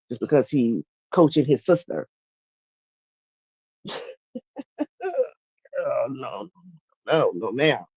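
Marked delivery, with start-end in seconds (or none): 4.3-5.3 high, soft giggle; amused laugh. Real, not acted. Streamer reacting to live chat, amused giggle. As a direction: giggle softly to yourself.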